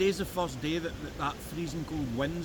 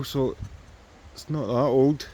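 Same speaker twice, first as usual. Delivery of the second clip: ordinary delivery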